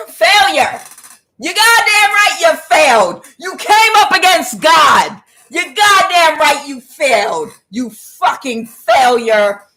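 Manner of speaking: loud, high berating shout; thunderous condemnation; furious shouting. Real, not acted. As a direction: yell it as a scathing verdict, drawn out at the end. Berating a failed opponent.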